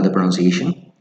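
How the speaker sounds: usual speaking voice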